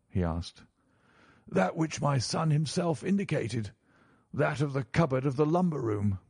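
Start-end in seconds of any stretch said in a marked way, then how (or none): 1.5-6.2 higher-pitched banker voice; answering banker. A narrator's reading.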